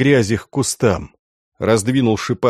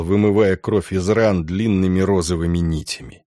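ordinary delivery, nothing marked

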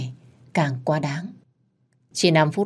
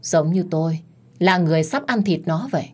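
usual speaking voice

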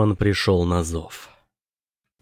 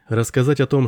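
ordinary delivery, nothing marked

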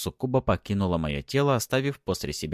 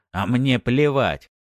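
loud, slow, raised retort; angry dismissal; 5 semitones higher, 6 dB louder, slower than usual. A narrator's reading. Husband brushing off wife's denial, angry. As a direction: spit out your indifference.

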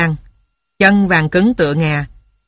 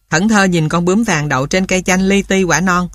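ordinary delivery

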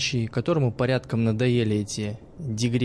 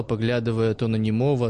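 usual speaking voice

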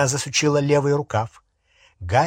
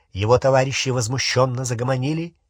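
plain reading voice

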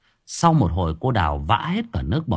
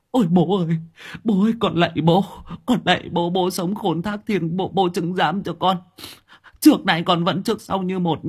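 put-on high, stuttering, frightened old man; stammering in panic; 8 semitones higher than usual. A narrator's reading. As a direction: beg the ghost in a trembling panic. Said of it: "Lão Hến lúc này ngớ cả người". (lão Hến lắp bắp sợ hãi van xin)

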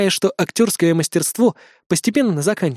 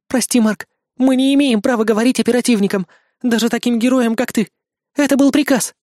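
put-on high, apologetic girl's voice; guilty idol girl; 6 semitones higher than usual. Idol girl apologises to Mark, high and pleading.

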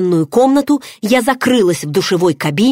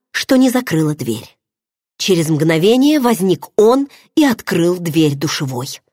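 plain reading voice

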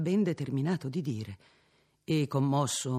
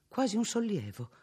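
usual speaking voice

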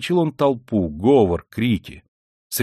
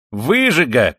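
higher, louder scornful cry; shouted insult; 4 semitones higher, 6 dB louder, slower than usual. A narrator's reading. Peasant woman abusing the stranger, shouted insult. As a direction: fling the name at him loudly. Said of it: "крикнула баба".

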